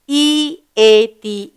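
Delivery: slow letter-by-letter spelling; spelled out slowly. Shown as a demonstration. Teacher spelling the word to the class, slowly. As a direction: spell each letter out for the children. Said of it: "So I fill in here with eat".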